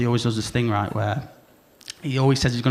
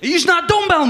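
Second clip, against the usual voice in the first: a high, loud jeering gym lad; mock gym lad; 5 dB louder than usual. Comic mocking the gym guys, high and loud. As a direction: squeal the jibe like a cocky gym bro.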